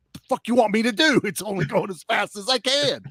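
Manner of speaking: high-pitched, rushed mock protest; exasperated protest. Call tracer answering the impatient villain, exasperated protest. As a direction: imitate a flustered underling pushed too hard.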